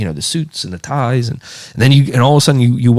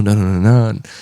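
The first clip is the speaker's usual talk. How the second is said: normal talking voice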